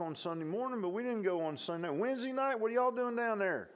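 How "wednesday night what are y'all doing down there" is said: high, mocking put-on voice; scoffing outsider. Preacher voicing an outsider mocking Sunday night church, high mock voice. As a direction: sneer at the church folks, pitched way up.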